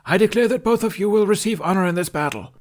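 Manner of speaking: high, loud storybook proclamation; loud stage narration. A narrator's reading. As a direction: drown them out with grand narration. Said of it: "king's closing verdict".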